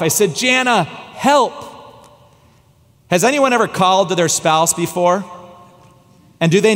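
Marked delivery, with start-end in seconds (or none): 0.4-1.6 weak call for help. Retold or imitated. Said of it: "to yell out to my wife".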